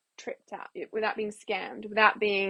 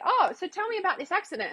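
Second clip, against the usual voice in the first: playing along; 9 semitones higher than usual. Toying with a scam caller, mock curiosity. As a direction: act innocently interested. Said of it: "I wanna say, playing with them".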